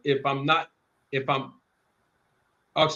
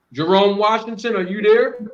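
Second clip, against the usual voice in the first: calling out; 4 semitones higher, 6 dB louder than usual. Real, not acted. Host calling guest on stream.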